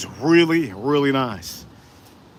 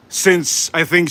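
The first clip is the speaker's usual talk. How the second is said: ordinary delivery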